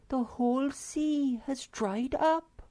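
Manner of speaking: high, slow breathless call; gasping Snork Maiden. A narrator's reading. Girl calls to friend in dismay, high and slow. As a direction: call his name in shock. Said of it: "gasped the Snork Maiden".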